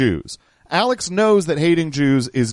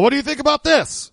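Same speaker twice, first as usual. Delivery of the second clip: mock yell, pitched way up; high-pitched hectoring, no real volume; Alex; 12 semitones higher than usual. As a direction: imitate a frantic challenge in a high voice. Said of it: "Alex is mostly just yelling about".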